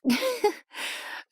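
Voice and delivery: a bright high laugh that rises; high amused laugh. Acted. Amused reaction.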